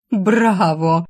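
loud mocking cheer; exclaiming. A narrator's reading. Alisa applauding her rival's staged tears, loud mocking bravo. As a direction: applaud the fake act with a loud cry. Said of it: "воскликнула Алиса".

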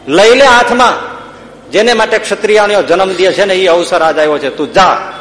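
high, loud, stretched-out order; rousing command. Retold or imitated. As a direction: act out a mother urging her son to arms. મા હથિયાર લેવા હુકમ કરે. (જુસ્સાથી હથિયાર ઉપાડવાનો હુકમ)

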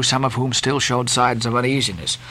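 plain reading voice

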